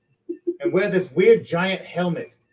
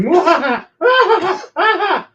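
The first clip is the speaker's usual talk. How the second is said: loud, much higher villain cackle; evil laugh; 10 dB louder than usual. Acted. Scientist alone in her lab at night, loud evil cackle. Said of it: "laughing, going".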